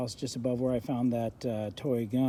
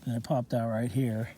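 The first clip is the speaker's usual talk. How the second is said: usual speaking voice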